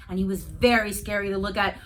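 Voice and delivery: ordinary delivery, nothing marked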